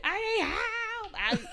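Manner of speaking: very high, slow, crying plea; desperate plea. Retold or imitated. Mom calling Noel for help, tearful and desperate. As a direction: beg for help near tears. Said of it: "I was like".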